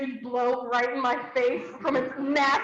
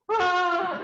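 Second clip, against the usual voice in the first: yell; 4 semitones higher than usual. Retold or imitated. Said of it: "And Tom was just like".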